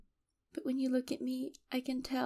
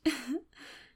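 brief breathy laugh; short chuckle; 3 semitones higher than usual. Acted. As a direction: let out a small, quick chuckle. Captor amused in acted monologue.

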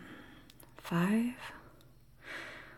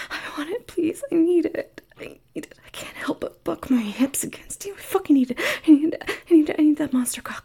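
desperate high begging, building louder; pleading; 6 semitones higher, 6 dB louder, faster than usual. Acted. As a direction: beg more and more urgently. Begging, rising urgency.

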